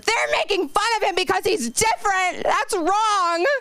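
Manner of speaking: put-on high, self-righteous kid voice, way up; a high mock-sincere little girl; mimicking a preachy kid. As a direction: play the tattletale seven-year-old, high and prim. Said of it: "Until you said".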